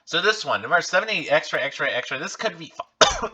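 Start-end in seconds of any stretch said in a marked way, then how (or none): 3.0-3.4 coughing on dust. Real, not acted. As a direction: voice catching, then a cough. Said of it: "I got some dust, dust in my, um, throat".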